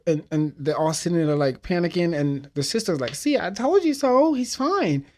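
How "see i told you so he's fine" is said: very high, loud told-you-so; smug sister. Retold or imitated. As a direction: sing it out smugly.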